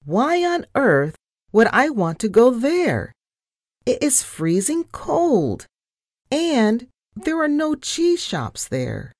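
high-pitched, squeaky indignation; squeaked protest. A narrator's reading. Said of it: "I squeaked".

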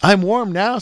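high-pitched, lightly sharpened jay voice; sharp bird voice. A narrator's reading. Jay answers the princess who warmed him, slightly sharp voice. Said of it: "said the jay in his sharp new voice".

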